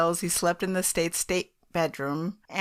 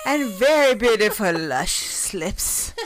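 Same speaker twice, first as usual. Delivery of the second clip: slow, louder put-on Hungarian diva voice; hammed-up Gabor glamour; 4 semitones higher, 4 dB louder, slower than usual. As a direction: purr it like a vamp film star. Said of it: "she sounded like".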